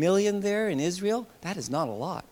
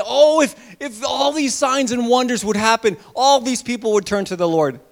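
raised, louder mock of sign-seekers; higher and louder, mimicking doubters; mocking quote; 6 semitones higher, 7 dB louder than usual. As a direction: scoff at their wish for miracles. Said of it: "some people say".

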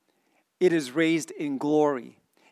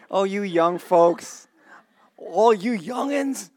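slow teasing; 6 semitones higher, 5 dB louder, slower than usual. Real, not acted. Teasing young folks.

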